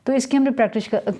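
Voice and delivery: normal talking voice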